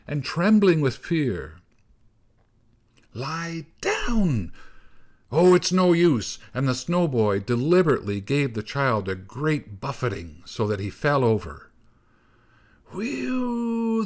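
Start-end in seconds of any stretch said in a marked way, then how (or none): 3.1-6.4 high, raised command; urgent, frustrated call; exasperated shout. A narrator's reading. Snow boy shouts at struggling child. 12.9-14.1 high, falling wind-like whoop; long, shrieking "whee-oo"; old snow woman's howl. A narrator's reading.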